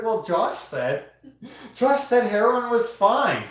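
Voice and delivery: high, slow, drawn-out mock quote; mock gullible follower. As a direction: imitate a naive fan, loud and high. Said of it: "Imagine someone's like".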